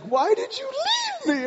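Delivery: very high, drawn-out accusation; mock outraged wail. Wife confronting husband in the cove, high indignant wail. Imitates wife's outraged complaint.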